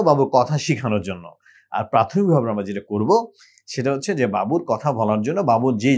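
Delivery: ordinary delivery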